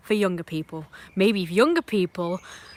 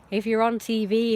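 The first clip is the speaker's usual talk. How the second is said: ordinary talk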